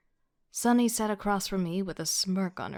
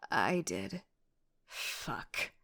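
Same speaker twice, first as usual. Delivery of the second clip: low muttered admission then whispered curse; embarrassed mutter; 8 semitones lower, 5 dB quieter, much slower than usual. Acted. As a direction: mutter it in shame. Embarrassed inner aside.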